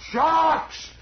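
high, sharp shouted command; hollered out. Retold or imitated. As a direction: yell it to the ground crew. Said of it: "hollered out".

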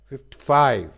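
teacher loudly stressing five in fifty five; stress on five. Shown as a demonstration. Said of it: "पे stress है ज़्यादा".

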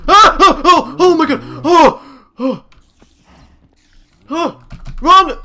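frightened yelps and cries; panicked yelling. Real, not acted. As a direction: yell out in real fright. Monster attacks, panicked yelling.

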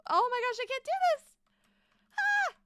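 high, loud wail of defeat; frustrated outburst. Real, not acted. Streamer stuck on a hard build, shrieking in frustration. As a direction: cry out that you can't manage it. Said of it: "This is so hard".

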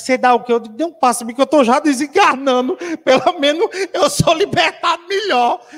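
loud, high-pitched comic desperation; hammed-up plea. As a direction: beg loudly, playing it for laughs. Retold comic plea for a blessing.

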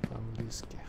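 hushed aside; whisper. Real, not acted. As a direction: whisper it under your breath. Aside after saying steak. (шёпотом)

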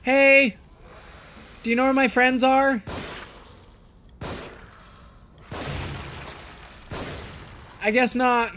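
high loud call into the dark. Real, not acted. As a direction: shout out to a stranger. Calling out to game character.

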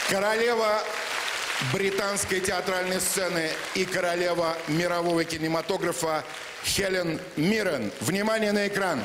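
announcer voice. Acted. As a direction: announce the star like a gala host. Host introduces star at ceremony.

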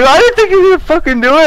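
high, rushed amazed squeal; mock cracking up. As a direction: imitate a friend in hysterical disbelief. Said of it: "they're just cracking the fuck up".

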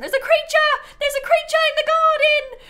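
put-on high, loud, laughing British-accented panic; husband yelling about a possum in a British accent; panicked British accent. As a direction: imitate a frightened Englishman at the window. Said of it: "saying in his British accent".

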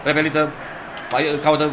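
normal talking voice

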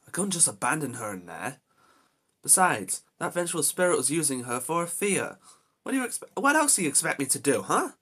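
loud exasperated protest; heated defending. Real, not acted. Gamer defending rescuing Jessie, heated and loud. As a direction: argue back hard in defence.

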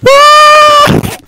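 very high loud dying scream; death cry. Acted. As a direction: let out a shrill dying scream. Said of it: "I should have done a death scream".

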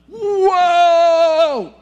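loud high cry of amazed relief; joyful whoa cry. Acted. Forgiven debtor crying out in amazed relief. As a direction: cry out in overwhelmed joy.

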